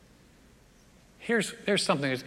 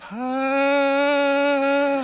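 long, high, held "ah"; mock healing chant; 8 semitones higher, 5 dB louder than usual. Imagined prophet waving his hands to heal, drawn-out showy "ah". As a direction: hold one showy note, high and loud. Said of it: "come out and wave his hands around".

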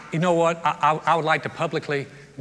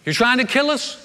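mock accusing, higher and louder; accusing whine; 6 semitones higher, 4 dB louder than usual. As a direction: imitate a crowd blaming Moses for everything. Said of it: "they always blame Moses".

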